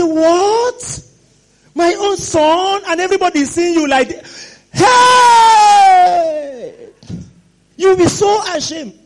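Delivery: high, loud cry of a shamed father; hammed-up outraged father yell. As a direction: shout it out, appalled at your own son. Father outraged at ragged son.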